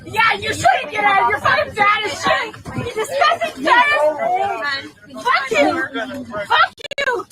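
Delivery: high, crying rant; angry tearful yelling. Real, not acted. Restrained passenger insults people round her, angry tearful yelling. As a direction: hurl the insults in a real, tearful rage.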